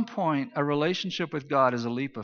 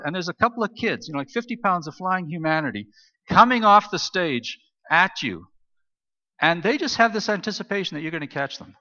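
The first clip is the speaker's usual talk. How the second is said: ordinary delivery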